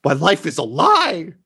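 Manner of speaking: high, hammed-up existential despair; mock despair. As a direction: wail that your life is a lie. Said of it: "He's like".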